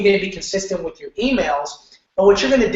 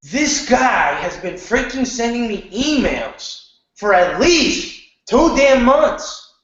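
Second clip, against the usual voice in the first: exasperated email reader; 5 semitones higher, much slower than usual. Retold or imitated. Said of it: "someone's gonna say".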